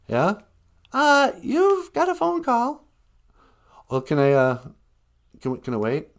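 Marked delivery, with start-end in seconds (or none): 0.9-2.8 much higher voice announcing a call; perky sidekick announcing a call; chirpy high announcement; high assistant voice. Acted.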